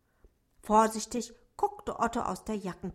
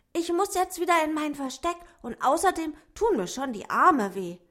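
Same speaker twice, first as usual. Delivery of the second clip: higher, quick little dwarf voice; dwarf saying goodbye; 6 semitones higher, 7 dB louder than usual. A narrator's reading. Dwarf Otto says goodbye to the rabbit, higher and quick. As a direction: hurry through the farewell. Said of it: "flüsterte er zum Abschied".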